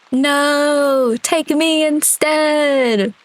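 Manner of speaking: high, drawn-out mock-heroic wail; melodramatic plea. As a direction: wail it slowly like a hammed-up martyr. Mock sacrifice in water fight.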